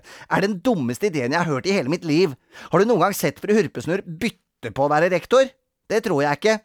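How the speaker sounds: put-on high, scornful child; indignant, cross tirade; bossy, grumpy girl. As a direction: scoff at a playmate's stupid idea.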